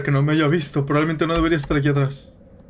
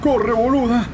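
higher-pitched, panicky commands to flee; urgent urging; 7 semitones higher, slower than usual. Real, not acted. Player urging the game character to flee, urgent. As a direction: urge them to run, pitch climbing.

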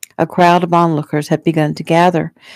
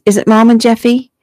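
higher, louder anxious question; alarmed; 6 semitones higher, 6 dB louder than usual. A narrator's reading. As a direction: ask it in rising alarm. Alarmed girl questions her brother.

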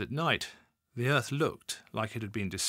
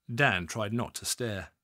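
ordinary delivery

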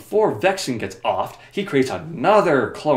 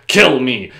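loud, punchy plea; mock dramatic demand; 9 dB louder than usual. Clone confronting a friend, loud dramatic demand. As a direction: belt it out as a melodramatic order. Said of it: "and says".

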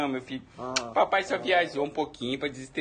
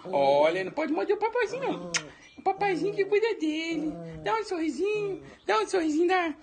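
put-on high, fond baby talk, louder at the end; baby talk to pet; 5 dB louder than usual. Dad coaxing a smile from his pet, high baby talk.

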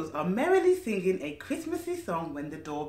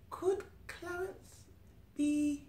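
soft, high, slow wondering hint; hint at the secret softly; mysterious hush; 8 semitones higher, 9 dB quieter, slower than usual. A narrator's reading. Mysterious hint about Santa.

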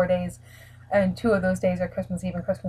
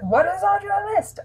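much higher, asking voice; high-pitched family member; 12 semitones higher than usual. Retold or imitated. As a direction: chirp it like a curious aunt. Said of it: "always like".

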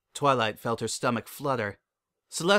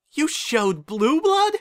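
very high, loud, slow outburst; shocked disbelief; 7 dB louder, slower than usual. Acted. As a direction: cry out in horror. Twilight shocked.

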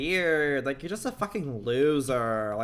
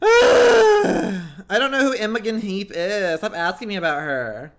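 loud exasperated groan, then an irritated complaint; real annoyance; 6 semitones higher, 10 dB louder than usual. Real, not acted. Streamer fed up with viewers asking about a singer, annoyed. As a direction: snap loudly, fed up with being asked. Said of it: "I'm so annoyed right now".

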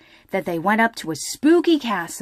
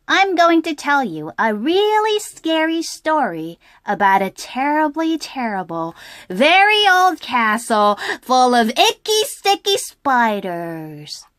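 Lola's voice; 6 semitones higher, 6 dB louder than usual. Acted. Lola telling scary story.

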